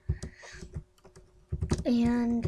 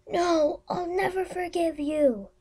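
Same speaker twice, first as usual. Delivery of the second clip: dramatic cry; 7 semitones higher than usual. Pretend. Acting betrayed character.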